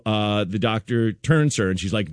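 normal talking voice

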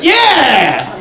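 high, loud, excited manager yell; flipping out. Retold or imitated. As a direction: panic like a manager who caught a thief. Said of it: "he's, like, flipping out".

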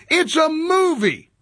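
drawn out, high, incredulous; exasperated. Real, not acted. Host scoffing at the film controversy, exasperated. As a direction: spell out the obvious, fed up.

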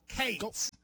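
"Cates" said as one long syllable; one-syllable drawl. Shown as a demonstration. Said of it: "It's like one syllable".

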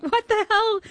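high, squeaky what the hell; stunned. Retold or imitated. Told Adam Sandler will phone her, stunned squeak. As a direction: gasp it out in shock.

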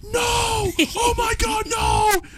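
very high-pitched mock scream; imitated screaming. Imitating friend who was screaming at the news, high scream. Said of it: "he was screaming".

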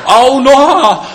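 high, loud cry of pain; roar of pain. A narrator's reading. Bitten bird Noha crying out, in a loud pained yell. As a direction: yell out as the snake bites. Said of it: "zařval bolestí pták Noha".

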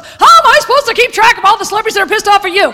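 fast, high, loud exasperated rant; exasperated Seinfeld. Retold or imitated. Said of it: "And Seinfeld goes".

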